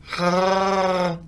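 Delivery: soft, long rolling creature growl; Chewy. Shown as a demonstration. Host greeting entrant Chewy with a namesake impression, drawn-out growl. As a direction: imitate a creature's drawn-out groan, fairly quietly. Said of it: "That was my Chewy impression".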